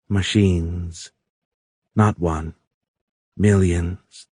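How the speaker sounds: lightly voiced alien, word by word; halting alien. A narrator's reading. Alien corrects captive Tony on the machines, halting. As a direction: spare correction with gaps between phrases.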